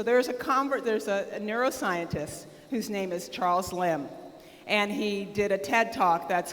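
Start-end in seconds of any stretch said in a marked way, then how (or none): none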